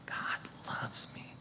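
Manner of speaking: very soft, slow whisper. Shown as a demonstration. Preacher shows another inflection, slow whisper. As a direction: let each word linger in a whisper. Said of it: "Say it in different inflections".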